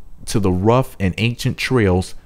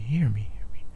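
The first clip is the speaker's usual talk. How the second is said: whispered echo of the call; fading echo; 9 dB quieter than usual. Acted. Cave echoing her call back, whisper.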